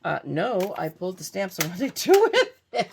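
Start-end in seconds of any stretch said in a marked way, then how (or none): 1.5-2.9 laughing through the question. Real, not acted. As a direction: chuckle while asking. Laughing at losing her stamp.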